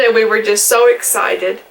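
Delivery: normal talking voice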